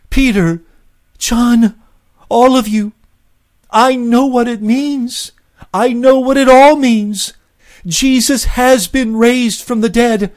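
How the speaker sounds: very high, loud, jubilant proclaiming; ecstatic announcement. A narrator's reading. Mary telling the disciples she has seen Jesus, ecstatic. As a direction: shout the good news overflowing with joy.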